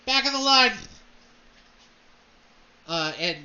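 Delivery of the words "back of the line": much louder, higher dismissive call; Blackthorn insisting. Acted. Noble shouting down at Devin to get in line, loud and dismissive. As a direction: brush them off loudly from above. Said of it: "He says".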